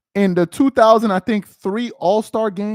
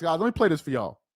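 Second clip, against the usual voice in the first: usual speaking voice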